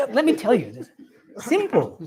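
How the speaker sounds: breezy mock self-assurance, pitch lifted; unbothered monk. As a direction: imitate a monk explaining it away. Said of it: "not in the least bothered".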